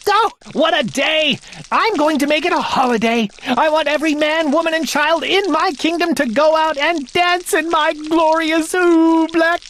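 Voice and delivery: king ordering a holiday; boastful king. Acted. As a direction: proclaim it gleefully.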